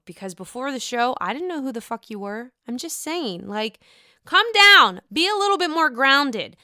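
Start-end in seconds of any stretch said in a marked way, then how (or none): 4.2-6.6 exasperated scold. Real, not acted. Scolding Candace directly.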